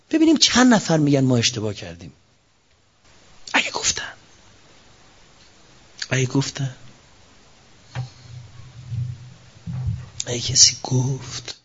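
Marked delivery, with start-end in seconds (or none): 3.5-11.6 soft whispered repeats, slowing down; hushed whisper. Acted. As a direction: drop to a whisper as if doubtful.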